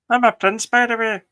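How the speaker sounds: high, loud mock-casual prince; mocking echo. Cohost echoing the prince's reveal, high mocking parody. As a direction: parrot the line to tease it. Friend echoes the prince line.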